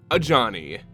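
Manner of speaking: usual speaking voice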